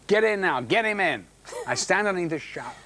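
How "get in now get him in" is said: call out. Retold or imitated. Crew calling him to the shower.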